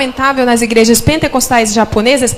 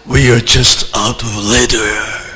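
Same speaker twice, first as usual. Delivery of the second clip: low, slow radio voice of the pilot; crackly cockpit announcement, slow and low; pilot cockpit headset; slower than usual. Retold or imitated. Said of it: "Eu escutei a voz do piloto".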